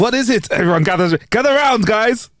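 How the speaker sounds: hammed-up call to gather round; mock detective call. Detective calling everyone together, high mock call.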